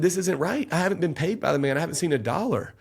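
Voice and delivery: high-pitched, insistent protest; indignant protest. Retold or imitated. Retold protest to jury.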